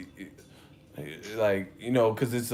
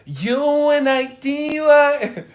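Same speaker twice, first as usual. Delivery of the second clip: very high, loud mock rap shout; 13 dB louder, slower than usual. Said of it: "on some [name] hype".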